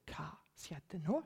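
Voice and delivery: slow, breathy inner whisper; whispered thought. Retold or imitated. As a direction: wonder it under your breath. (hviskende undring)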